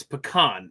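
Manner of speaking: pecan with stress on a long "kahn"; pecan as puh-KAHN. Shown as a demonstration. Host insisting on the only right way, pecan as puh-KAHN. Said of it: "This one is only one way".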